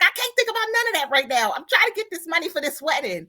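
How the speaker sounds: stressed retort. Retold or imitated. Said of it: "I was like".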